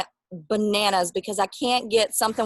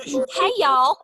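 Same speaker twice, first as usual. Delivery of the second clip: louder, higher Southern "hey, y'all" greeting; Southern accent; 6 semitones higher, 6 dB louder than usual. Pretend. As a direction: greet the viewers like a hammed-up Southern weather girl. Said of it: "in your beautiful accent".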